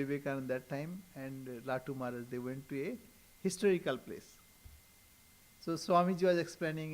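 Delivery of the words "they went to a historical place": ordinary talk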